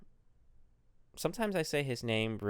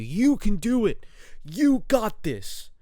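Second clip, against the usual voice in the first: high, loud, slow pep-talk voice; motivational speaker; 11 semitones higher, 9 dB louder, slower than usual. Pretend. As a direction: pump them up like a hype coach. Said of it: "motivational speaker vibes".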